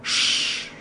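long "shh" for silence; unvoiced warning shush; shushing hiss. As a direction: hush the child. Witch hushes the girl.

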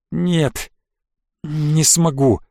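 weak, strained refusal; pained creak. A narrator's reading. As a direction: creak it out through pain. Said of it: "проскрипел он болезненно". (болезненно, со скрипом)

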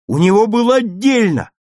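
high, loud protest; indignant shout. A narrator's reading. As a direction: shout out in a friend's defence. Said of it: "крикнул Игорь". (возмущённый крик в защиту товарища)

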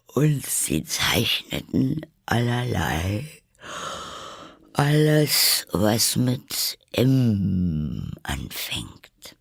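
yawning, drawn-out words; very sleepy. A narrator's reading. Dormouse dozes off telling the tale, slow and sleepy. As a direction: stretch words through yawns as you doze off. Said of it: "sehr schläfrig zu werden".

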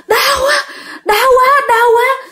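high-pitched cries of hurt; acted pain. Stabbed ghost rolls on the ground, crying in pain. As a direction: wail in pain, voice pitched far up. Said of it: "bà Bảy đó lăn ra ôm".

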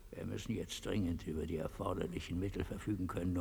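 usual speaking voice

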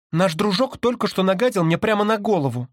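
very high indignant voice; outraged sister. A narrator's reading. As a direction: complain high and offended.

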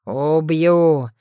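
a growled, inhuman threat; dragon's roar. A narrator's reading. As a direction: snarl it like a beast. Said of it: "драконий рык". (драконий рык)

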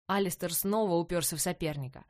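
plain reading voice

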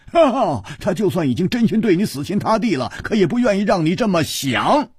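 high, loud, triumphant agreement; loud exclamation. A narrator's reading. Friend cheering the princess's point, loud and excited. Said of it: "大声说".

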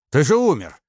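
high, loud disbelieving cry; shocked exclamation. A narrator's reading. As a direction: exclaim as if seeing a dead man. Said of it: "вскричал Скуратов". (потрясённо, громко и высоко)

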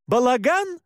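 high, incredulous yell. A narrator's reading. Narrator throwing the word back at Pascal, yelling. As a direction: yell it back as an outraged question. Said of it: "заорал я".

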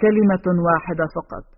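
ordinary delivery

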